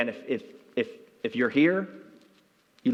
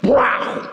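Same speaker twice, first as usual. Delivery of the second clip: wow exclamation; 8 semitones higher, 7 dB louder than usual. Retold or imitated. Fish strikes the lure.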